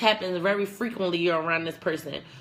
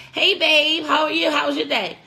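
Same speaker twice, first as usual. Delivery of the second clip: bright, much louder and higher greeting; chirpy hello; 7 semitones higher, 5 dB louder than usual. Retold or imitated. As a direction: call out happily to the partner as you walk in. Cheerful greeting to a partner at home.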